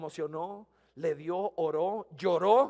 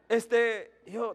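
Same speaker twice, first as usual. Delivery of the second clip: usual speaking voice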